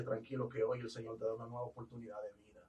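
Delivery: soft, comforting reassurance; calm the man gently; tender comfort to a freed prisoner; reassuring. Retold or imitated.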